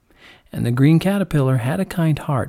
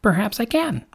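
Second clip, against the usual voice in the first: lightly voiced high lark; a hint of an airy lark; lark character; 9 semitones higher than usual. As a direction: answer in a raised bird voice.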